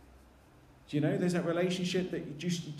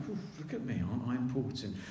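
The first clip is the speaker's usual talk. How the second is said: softer, preening mock boast; smug self-importance; 6 semitones lower, 5 dB quieter, faster than usual. Disciples getting too big for their boots, mock smug. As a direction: lightly puff yourself up, mock smug. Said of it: "too big for their boots".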